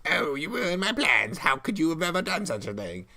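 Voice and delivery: the defeated witch complaining. Acted. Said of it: "That actually helps the witch voice".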